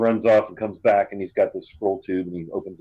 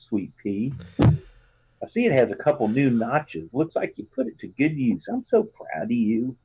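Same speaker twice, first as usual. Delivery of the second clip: put-on a fond Southern mom writing to her child; Southern mother; 3 semitones higher than usual. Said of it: "really Southern".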